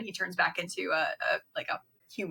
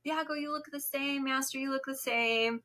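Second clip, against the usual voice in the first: put-on frail old man; 6 semitones higher than usual. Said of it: "he's like".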